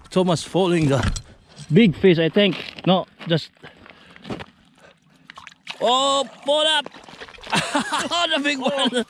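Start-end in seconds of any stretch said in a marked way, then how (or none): 5.8-9.1 excited. Real, not acted. Big fish on the line.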